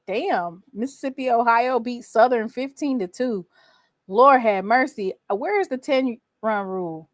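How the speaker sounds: normal talking voice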